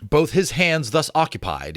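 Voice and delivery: ordinary delivery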